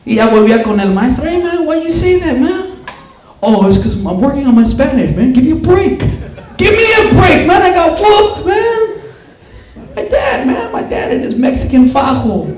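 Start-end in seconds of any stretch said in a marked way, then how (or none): 1.3-2.7 put-on higher, softer street-kid challenge; teen confronting. José confronting teacher, higher teen voice. 3.4-12.5 mocked teacher. Teacher excusing himself to José, mocking laughter.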